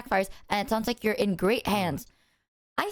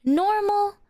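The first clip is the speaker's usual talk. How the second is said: therapist imitation; 10 semitones higher, 4 dB louder than usual. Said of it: "And they're like".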